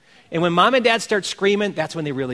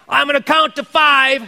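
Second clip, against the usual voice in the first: mock parent threat; 8 semitones higher, 6 dB louder than usual.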